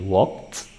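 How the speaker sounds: "walked" as wokt with an audible t; walked with t ending. Shown as a demonstration. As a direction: release the t at the end of "walked". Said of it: "ada bunyi t".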